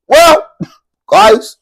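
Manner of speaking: a loud, high burst of laughter; laughing. Real, not acted.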